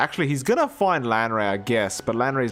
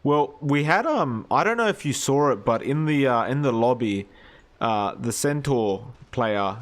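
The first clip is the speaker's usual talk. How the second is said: ordinary talk, nothing marked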